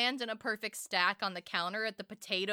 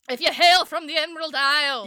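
much louder, booming mock preacher line; mock Irish proclamation; 4 semitones higher, 11 dB louder than usual. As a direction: belt it like a revival preacher.

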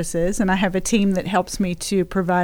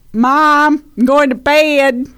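louder, higher Southern American twang; newly American kid with a Southern accent; 4 semitones higher, 4 dB louder, slower than usual. Acted. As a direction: stretch "bed" in a Southern accent. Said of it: "a full-on American".

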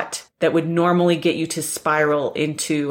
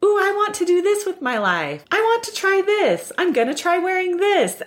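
put-on eager little child; 14 semitones higher than usual. Podcaster voicing her younger self, an excited much higher child voice. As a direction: squeal it out like a small child showing off. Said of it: "where you were like".

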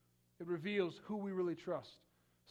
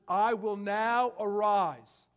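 slow, loud, ringing declaration of the Lord; thundering divine promise; booming proclamation; 3 semitones higher, 9 dB louder, much slower than usual. Acted. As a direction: proclaim it slowly with full force. Preacher voicing the Lord's promise.

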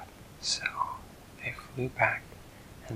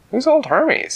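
a higher, squeakier character voice; same question pitched way up; much higher voice; 9 dB louder than usual. A narrator's reading. Second character repeats question.

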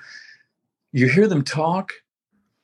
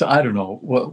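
ordinary delivery

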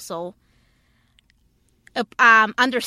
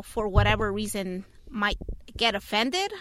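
ordinary delivery

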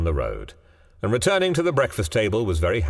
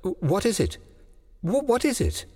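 rising, high frightened questions; breathless panic; 10 semitones higher than usual. A narrator's reading. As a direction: stammer it out in growing fear. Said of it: "she asked breathlessly".

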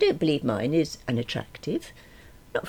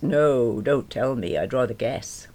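usual speaking voice

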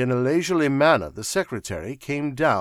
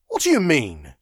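startled raised-pitch exclamation; crying out; 5 semitones higher, 6 dB louder than usual. A narrator's reading. Wilson bounding off the table, crying out. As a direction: cry it out in disbelief, without shouting. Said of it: "he cried".

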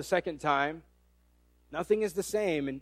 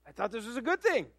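softer, bewildered protest; baffled husband; 2 semitones higher, 4 dB quieter, faster than usual. Acted. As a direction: say it as a puzzled husband would. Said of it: "It'd be like if".

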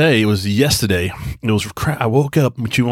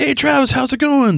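bright, much higher mock greeting from the pizza staff; a high, friendly shop-counter hello; cheery greeting. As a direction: chirp it like a friendly cashier.

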